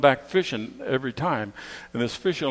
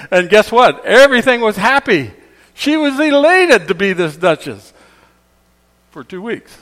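much higher, much louder, gleeful; excited storytelling; 10 semitones higher, 13 dB louder than usual. A narrator's reading. Preacher telling the fish tale, loud and gleeful. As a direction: sell the reveal to the congregation.

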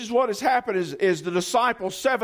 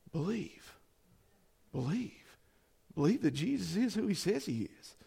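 soft plea; 3 semitones lower, 12 dB quieter than usual. Acted. Pastor urging simple belief.

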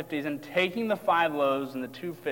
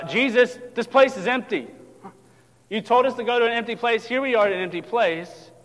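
louder, higher protest; tense complaint; 5 semitones higher, 5 dB louder than usual. Acted. Disciples complain to Jesus, tense and raised. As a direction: push back at the one who sent you. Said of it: "see the tension rising in the story".

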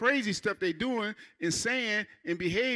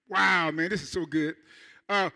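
ordinary talk, nothing marked

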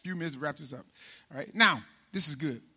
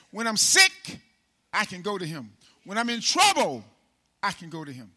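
preaching cadence; 7 dB louder than usual. Acted. Call and answer preaching.

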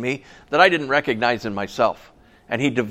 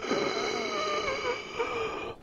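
long, high, soft gasp of dread; hammed-up intake of alarm; mock panicked gasp; 11 dB quieter than usual. Retold shock at funeral request.

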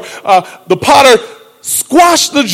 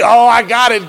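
normal talking voice

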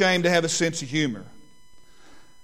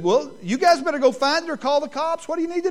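feigned concern; 10 semitones higher than usual. Retold or imitated.

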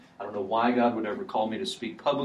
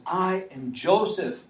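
slow reveal; 7 semitones higher, slower than usual. Acted. Joseph reveals himself to brothers.

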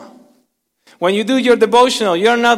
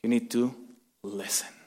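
normal talking voice

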